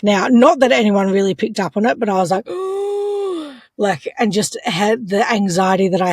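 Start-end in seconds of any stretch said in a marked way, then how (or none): 2.5-3.5 high, drawn-out mock gasp; anxious gasp. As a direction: imitate a panicked intake of breath. Acts out her anxious reaction.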